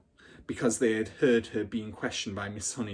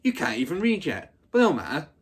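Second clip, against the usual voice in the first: louder, higher brush-off; dismissive bully; 5 semitones higher, 6 dB louder than usual. Acted. Hortensia brushing off Matilda, louder. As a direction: wave her off loudly.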